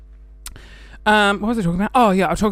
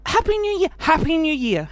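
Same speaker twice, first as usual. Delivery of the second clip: high, sing-song forced cheer; lightly voiced fake-cheerful well-wisher; mock cheery greeting; 9 semitones higher than usual. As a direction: ham up a fake festive greeting. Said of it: "something we're forced to say".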